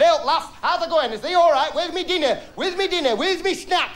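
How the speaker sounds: hammed-up hungry husband; husband demanding dinner. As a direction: bellow for your dinner, high and cheeky. Husband voiced coming home.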